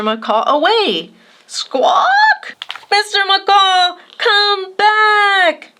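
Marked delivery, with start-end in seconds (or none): 1.5-2.6 very high, desperate squawk; panicked parrot screech. A narrator's reading. The parrot kite screeching as the gust carries it away. 2.9-5.7 high, loud, slow call after the kite; desperate shout. A narrator's reading. Maxton shouting after the kite blown away by the storm, loud and high. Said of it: "shouted Maxton".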